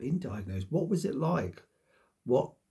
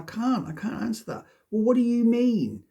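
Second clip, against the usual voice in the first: higher and louder, irritated retort; imagined snapping back; 9 semitones higher, 7 dB louder than usual. Retold or imitated. Imagining answering the doctor, irritated and louder. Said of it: "I'm like".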